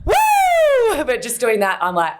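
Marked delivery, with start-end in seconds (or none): 0.1-1.0 very high, loud woo; a shrieking whoop at the cold; cold shock whoop. Retold or imitated. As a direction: yelp as icy water hits you. Hit by cold shower water.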